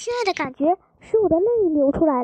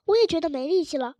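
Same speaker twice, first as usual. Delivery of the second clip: plain reading voice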